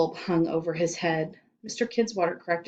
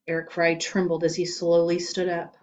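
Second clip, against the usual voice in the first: ordinary delivery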